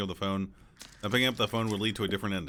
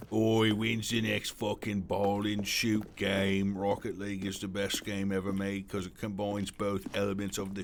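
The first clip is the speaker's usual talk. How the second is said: slow, oafish British shooter fan parody; hammed-up British accent making fun of gamers; mocking British accent; slower than usual. Streamer making fun of shooter fans, mocking British accent. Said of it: "I'll make fun of those people".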